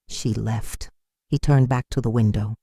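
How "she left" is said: low and soft, flat; flat, quiet. Acted. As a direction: son says it drained and soft, flat and quiet. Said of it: "His voice was flat".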